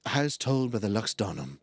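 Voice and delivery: a hint of seductive narration; sexy narration voice. Shown as a demonstration. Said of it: "my sexy narration voice".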